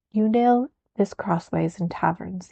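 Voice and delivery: plain reading voice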